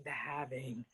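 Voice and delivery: deep, quiet movie trailer voice; spooky horror trailer voice. Pretend. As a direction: say it like a scary film title. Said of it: "Great horror movie by John Carpenter".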